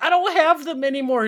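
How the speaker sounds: high, loud retold protest; mock protest. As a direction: imitate someone defensively yelling back. Retold quarrel over missing drugs.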